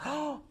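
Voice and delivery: high, gasped "oh"; mock gasp of shock; imitated audience gasp. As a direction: gasp like a startled onlooker. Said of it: "I could see the audience going".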